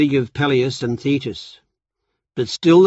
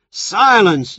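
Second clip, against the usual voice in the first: loud, much higher bellowed command; shout; 8 semitones higher, 6 dB louder than usual. Acted. Zeus stopping the goddesses' fight, loud shout. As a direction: blast the word to stop the fight. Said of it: "Zeus shouted".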